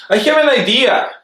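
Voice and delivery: high, eager exclaim; yelled. Acted. The eggplant yells his idea to the stuck gang. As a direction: burst out with the idea, light and bright. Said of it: "yelled the Tuscan eggplant".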